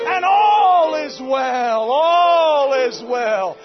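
slow, loud, stretched words; drawn-out declaration. Real, not acted. Preacher declaring victory to the church, slow and loud. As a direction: hold each word out to the back row.

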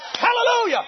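ordinary talk, nothing marked

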